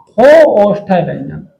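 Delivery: normal talking voice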